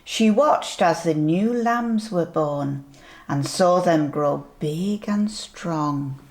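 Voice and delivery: ordinary delivery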